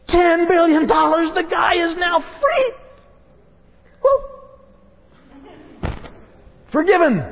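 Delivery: high, ringing cry of joy; jubilant shout. Real, not acted. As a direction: shout out the good news. Preacher celebrates the forgiveness.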